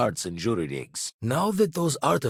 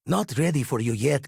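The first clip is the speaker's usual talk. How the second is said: usual speaking voice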